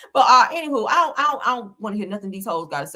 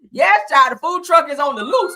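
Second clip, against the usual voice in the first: shouting; 5 semitones higher, 8 dB louder than usual. Pretend. Calling out the alarm.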